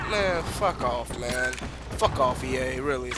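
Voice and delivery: disgusted mutter. Real, not acted. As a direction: mutter it under your breath. Muttering at EA after rant.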